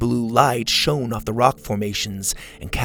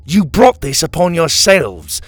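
loud, raised, triumphant rebuke; imperious proclamation; 7 semitones higher, 7 dB louder than usual. A narrator's reading. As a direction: build to a big ringing finish. Villain rebukes prisoner, proclaiming victory.

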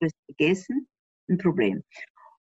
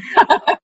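short high burst of laughter; 13 semitones higher, 6 dB louder than usual. Real, not acted. Guest laughing at the professor's flattering call, high laugh. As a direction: laugh brightly.

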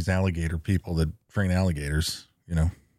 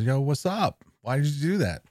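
frog voice; 6 semitones higher than usual. Retold or imitated. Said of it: "and the frog says".

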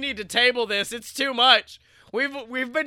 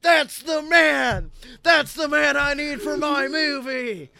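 loud, high, hammed-up movie star cry; excited Nicolas Cage; 5 semitones higher, 7 dB louder, slower than usual. Nicolas Cage spotting his man for the movie, excited shout. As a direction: shout it like you've made a great discovery. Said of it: "he's like".

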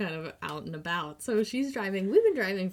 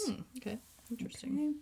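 mock-intrigued whisper; 4 semitones higher, 9 dB quieter, faster than usual. Real, not acted. Cohost whispers a teasing aside about bad turn-taking, whispered.

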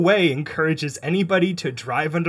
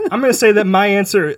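loud; 5 semitones higher, 10 dB louder, faster than usual. Real, not acted. Loud boast.

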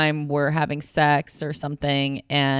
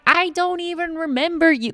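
much higher, dismissive disbelief; incredulous retort; 13 semitones higher than usual. Retold or imitated. She answers her ex's love message, in a high incredulous voice. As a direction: brush him off in disbelief. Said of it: "and I'm like".

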